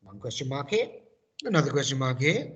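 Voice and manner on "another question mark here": usual speaking voice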